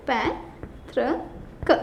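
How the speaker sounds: पैतृक, slow and hushed; slow soft pronunciation. Shown as a demonstration. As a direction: draw out पैतृक quietly. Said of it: "इसको ऐसे लिखते हैं".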